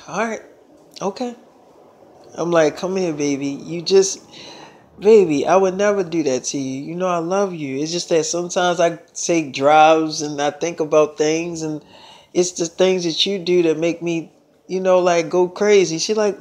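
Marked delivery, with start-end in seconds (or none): 0.1-1.3 grudging okay. Retold or imitated. Girlfriend reluctantly accepting denial. 2.8-16.0 put-on soothing boyfriend. He soothes his girlfriend.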